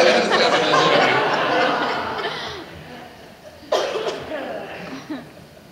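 long, loud rising laugh; laughter. Real, not acted. Pastor laughing at his friend, long laugh.

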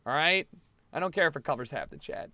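normal talking voice

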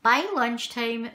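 ordinary delivery, nothing marked

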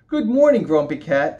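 high, loud and cheerful; bright chirpy little bug greeting; ladybug voice. Acted.

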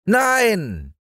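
put-on high, defiant child's yell; boy yelling no. Häwelmann refusing the moon, high defiant child yell. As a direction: shout it like a stubborn little boy. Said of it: "schrie Häwelmann".

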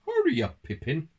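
high, loud call dropping on the name; urgent town mouse. A narrator's reading. Town mouse urging country mouse on, high urgent call. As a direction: hurry your friend along in a high voice. Toby urges his friend on.

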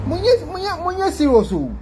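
high, urgent pleading; begging them not to take it seriously; high-pitched plea to viewers; excited plea. Real, not acted.

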